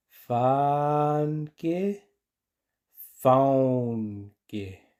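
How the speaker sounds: Fanke then faunque said slowly; teacher contrasts the two for learners. Shown as a demonstration. Slow contrast. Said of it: "Escucha bien".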